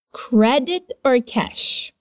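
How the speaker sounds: slow, stress on or, falling at the end; slow drill. Shown as a demonstration. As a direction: spell it out slowly for the class. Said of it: "One more time".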